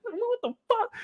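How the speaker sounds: high startled what the fuck; shocked. Pretend. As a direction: jump at a sudden pop. Shocked by leak.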